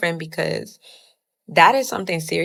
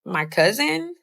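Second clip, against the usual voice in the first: high rising question; pitched-up retold question, rising at the end; retold disbelief; 7 semitones higher, slower than usual. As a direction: guess in disbelief, re-enacted. Acting out her guess at culprit.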